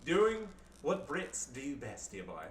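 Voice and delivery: very slow, lightly posh Englishman; mild mock upper-class drawl; posh Brit. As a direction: draw it out like a smug toff. Posh Brit running gag.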